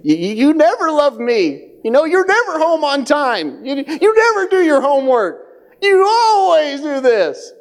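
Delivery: high, whiny mock accusations; exaggerating complainer. Exaggerating family members complaining at home, high mock wail. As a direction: wail each accusation, hammed up. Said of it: "And when we exaggerate".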